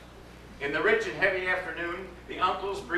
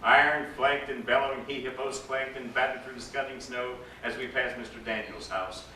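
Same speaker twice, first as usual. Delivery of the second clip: ordinary delivery, nothing marked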